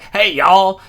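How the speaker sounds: loud, high mock Southern drawl of a greeting; Southern accent. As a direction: belt out a hammed-up Southern hello. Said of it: "why is this accent everywhere".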